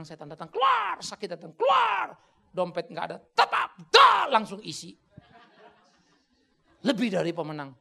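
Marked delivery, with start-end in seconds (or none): 0.0-5.0 high, loud mocking boast; sarcastic hammed-up cheer; mock shallow triumph. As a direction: parody the easy-victory crowd. Mocking shallow victory talk.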